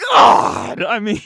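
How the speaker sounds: loud cringing outburst of disgust; exasperated shout. Real, not acted. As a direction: blurt it out, totally grossed out. Cringing at a creepy fan post.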